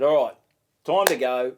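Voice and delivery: loud call across the bar. Retold or imitated. Comic calling to the barman, loud. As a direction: announce it loudly to the bartender.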